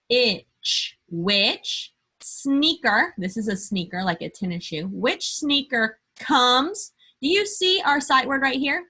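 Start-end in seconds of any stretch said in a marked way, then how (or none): 1.1-3.1 slow teacher question. Acted. Slow drawn-out question to kids. 4.9-8.9 slow teacher question. Acted. Slow drawn-out question to kids.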